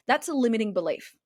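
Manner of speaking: usual speaking voice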